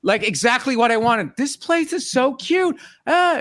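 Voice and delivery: hammed-up wife swooning over a cute cafe; gushing wife. Husband mimicking wife's delight at the coffee shop, gushing. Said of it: "she's like".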